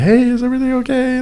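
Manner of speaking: high-pitched, careful check-in; retold solicitous question; imitated gentle concern. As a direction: ask as if checking on someone upset. Checking on the emotional mom.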